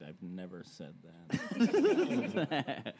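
slow, laughing protest; laughing slow denial. Real, not acted. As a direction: deny it while laughing. Denial repeated slowly laughing.

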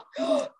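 sharp high gasp of shock; a quick high intake of breath. Retold or imitated. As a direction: gasp as if stunned. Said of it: "were like".

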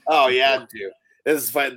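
ordinary talk

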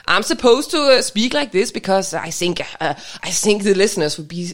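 put-on thick Danish-English accent. Shown as a demonstration. Co-host showing how a Dane should speak English, Danish accent. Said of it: "like a Dane rather than like an American".

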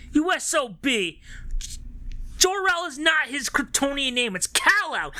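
high, indignant nerd rant; hammed-up fanboy scolding; mock outrage. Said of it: "turned to my sister and was like".

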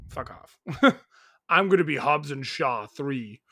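a hint of The Rock's growl, deadpan; Rock impression. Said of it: "That's my Rock impression, guys. It's terrible".

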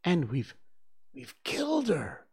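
halting, high-pitched hoarse cry; horrified, narrated as hoarse. A narrator's reading. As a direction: catch on the words, then go high. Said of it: "Nib said hoarsely".

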